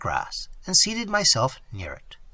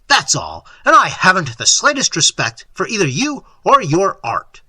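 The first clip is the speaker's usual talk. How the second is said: loud, scornful telling-off; forceful contemptuous rant; menacing tirade; 4 semitones higher, 6 dB louder than usual. A narrator's reading. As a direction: heap insults on him emphatically. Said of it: "menacing him with outstretched hand".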